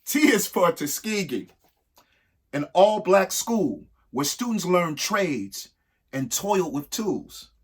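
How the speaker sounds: rhythmic, lilting read-aloud of a rhyming book; reading to children. Acted. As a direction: read each verse to the kids with a bounce.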